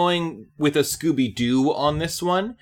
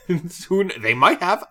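ordinary talk

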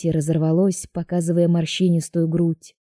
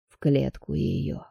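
low, slow cold order; menacing mage; 4 semitones lower, 6 dB quieter, slower than usual. A narrator's reading.

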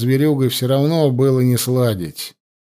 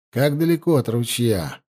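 usual speaking voice

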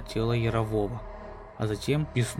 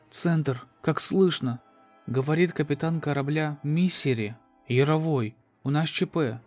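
higher, tense distress report; urgent radio call; 5 semitones higher than usual. Acted. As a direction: call for help over the radio. Captain's distress radio message.

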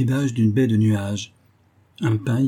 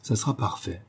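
murmured; 6 dB quieter than usual. A narrator's reading. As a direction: murmur it under your breath. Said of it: "et murmura". (murmuré, à voix basse)

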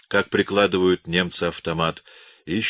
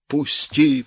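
choked, raised wheeze; hoarse wheeze; 8 semitones higher than usual. A narrator's reading. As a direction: wheeze it out while being choked. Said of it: "прохрипел полицай".

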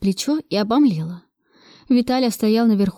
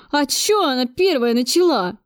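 put-on slow, higher childish whine; whimpering; 4 semitones higher than usual. A narrator's reading. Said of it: "прохныкал Виталя". (плаксиво, по-детски хныча)

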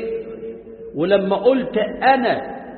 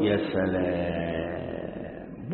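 long drawn-out, much lower sarcastic sigh; mock sarcasm; 12 semitones lower, 8 dB quieter, much slower than usual. Preacher mocking the vain man, sarcastic drawl. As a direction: stretch it out, mocking his pride.

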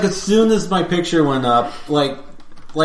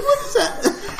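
very high, drawn-out squeal of disbelief; mock horrified disbelief; 3 dB quieter, much slower than usual. Crowd seeing the baby picture, mock horrified. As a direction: squeak it slowly, laughing through it.

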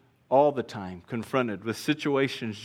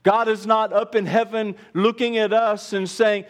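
high-pitched, driving preacher's voice; emphatic pulpit delivery; forceful preaching; 8 semitones higher, 4 dB louder than usual. Real, not acted. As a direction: preach it hard, voice raised throughout.

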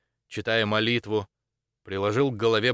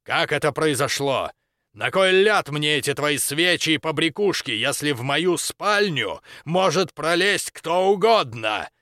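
raised, high-pitched angry demand; angry tirade; 5 semitones higher, 4 dB louder than usual. A narrator's reading. Baron berates the dean over his bedroom's protection, angrily. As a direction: build into a loud, indignant rant.

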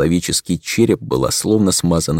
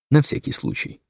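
playful burglar whisper; 7 semitones higher, 4 dB quieter than usual. Acted. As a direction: whisper it like a conspirator. Sly aside whispered.

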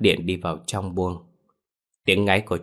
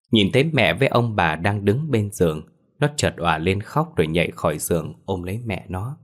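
plain reading voice

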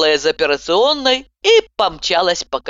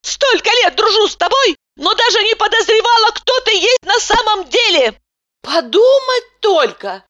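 indignant outburst; 14 semitones higher than usual. A narrator's reading. Patient rails at her doctor friend in outrage. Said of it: "высказала своё отношение к её произволу".

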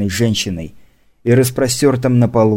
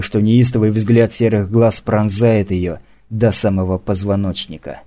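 ordinary delivery, nothing marked

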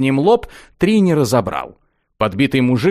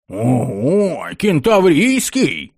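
delighted yell; 2 semitones higher, much slower than usual. A narrator's reading. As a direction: cry out in greedy delight. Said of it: "восторженно завопил первый".

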